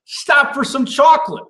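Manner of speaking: catchphrase. Acted. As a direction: toss out the refrain like a jingle. Recurring catchphrase refrain.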